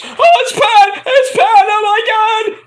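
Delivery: very high, loud, slow squealing mock commentator; mock fanboy squeal. Commentator spotting Pat McAfee, mocked as a very high squealing fanboy. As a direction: shriek it like a commentator losing it over his idol. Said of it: "freaking Michael Cole has an orgasm in his pants".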